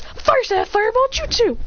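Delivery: high-pitched anime move shout; attack call. Acted. Rival launching fireball at his opponent, high attack call. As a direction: announce the jutsu high and intense.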